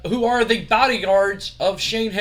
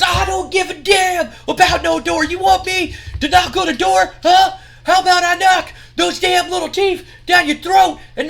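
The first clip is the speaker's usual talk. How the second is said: much higher, much louder screamed threats; furious threat; 12 semitones higher, 8 dB louder than usual. Retold or imitated. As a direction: scream it right in his face. Scott Steiner threatens Jeff.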